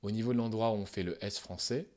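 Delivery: usual speaking voice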